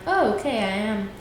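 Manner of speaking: ordinary talk, nothing marked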